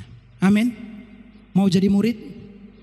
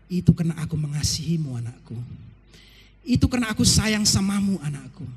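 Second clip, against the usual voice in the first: tender fatherly God; 9 semitones lower than usual. Retold or imitated. God holding his crying child, lower tender voice. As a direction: comfort a weeping child in your arms. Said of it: "dia peluk kita dan berkata".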